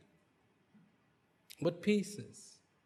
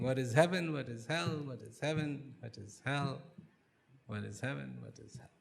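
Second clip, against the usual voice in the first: repeating the question, softer and softer until almost silent; questions fading to a murmur; 5 dB quieter than usual. Acted. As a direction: let the question trail away into nothing. King's repeated question, fading away.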